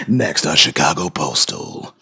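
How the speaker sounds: very low, slow announcer; hammed-up deep trailer voice teasing the next episode; TV promo voice. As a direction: intone it like a dramatic TV promo. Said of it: "I could do that with this voice".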